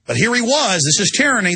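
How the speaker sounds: ordinary delivery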